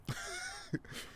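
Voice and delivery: very high mock witch cackle; shrill imitation of mom's laugh. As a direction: cackle like a fairy-tale witch. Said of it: "she sounds like a witch".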